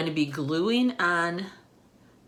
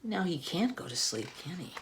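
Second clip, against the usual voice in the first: usual speaking voice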